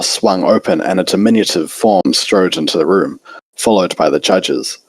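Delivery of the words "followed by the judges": ordinary delivery, nothing marked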